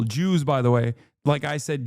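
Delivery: ordinary talk, nothing marked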